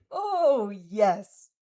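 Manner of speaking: a loud, teasing yes; loud ironic yes. Acted. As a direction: burst out with a knowing, loud yes. Athena's loud ironic reply.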